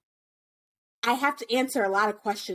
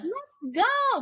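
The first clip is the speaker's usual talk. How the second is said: much higher, slow, sugary service voice; customer service voice; 12 semitones higher than usual. Acted. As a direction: sweet-talk the class like a shop clerk. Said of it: "put on my customer service voice".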